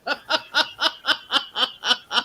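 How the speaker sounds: helpless giggling burst; real laugh. Real, not acted. Friend cracking up at the Deirdre's neck joke, laughing. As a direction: laugh at the silly comparison.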